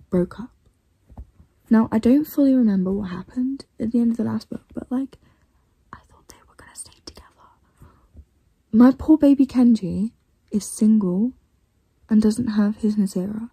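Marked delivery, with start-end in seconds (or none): 5.9-7.5 fast hushed whisper. Real, not acted. Vlogger filming late at night, whispering. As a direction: whisper so no one wakes.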